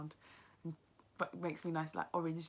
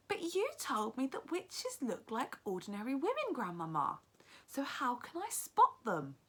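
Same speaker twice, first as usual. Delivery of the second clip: put-on higher child's voice, puzzled; young boy; 8 semitones higher, 5 dB louder than usual. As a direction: ask like a confused boy. Boy questions grandmother.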